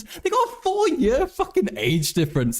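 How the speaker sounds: high-pitched laughing disbelief; incredulous outburst. Real, not acted. Streamer on the candidates' age gap, high incredulous outburst. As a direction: squeal it, incredulous.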